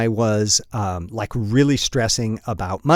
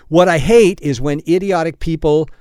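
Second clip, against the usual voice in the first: normal talking voice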